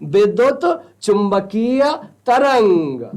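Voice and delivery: drawn out word by word; slow, deliberate teacher's enunciation; slow dictation. Real, not acted. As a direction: give each word of the term its own slow beat. Teacher spells out key term slowly.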